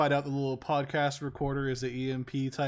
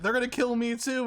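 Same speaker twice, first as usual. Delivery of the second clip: higher, lightly alarmed quote; mock alarm; 7 semitones higher than usual. Quoting Millie on stage, mock alarm. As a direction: imitate someone afraid for their life.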